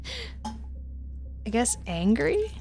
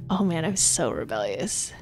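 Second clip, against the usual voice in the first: normal talking voice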